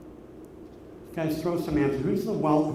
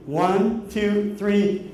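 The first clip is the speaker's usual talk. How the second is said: ordinary delivery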